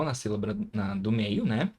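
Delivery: ordinary talk, nothing marked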